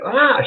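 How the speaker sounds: retold high pain cry; pained yell. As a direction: yelp as if shaking off pain. Acts out shaking off the pain.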